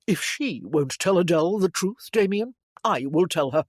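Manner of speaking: plain reading voice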